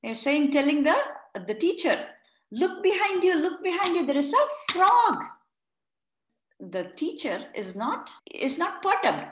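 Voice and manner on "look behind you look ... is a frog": put-on higher, excited children's warning; urgent kid warning; squealing kids. Kids warn teacher about frog, high and excited. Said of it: "they started squealing".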